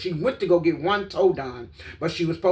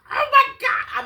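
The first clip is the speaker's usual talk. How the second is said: high shocked cry; horrified shout; 6 dB louder, slower than usual. Real, not acted. Reacting to the surgery video with a shocked cry. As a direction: gasp it out in shock.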